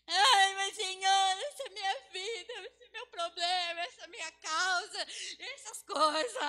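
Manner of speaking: high, loud, hammed-up wailing; exaggerated sobbing believer, drawn out at the end; mock crying prayer. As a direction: wail out a fake tearful complaint to God. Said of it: "Você tá chorando".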